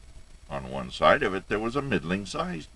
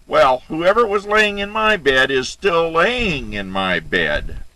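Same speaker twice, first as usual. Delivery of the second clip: very high, loud cub wail; angry baby bear; 11 semitones higher, 9 dB louder than usual. A narrator's reading. Baby bear finds Goldilocks in his bed, high angry cry. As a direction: shriek it as an outraged little bear. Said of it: "a baby bear voice of anger again".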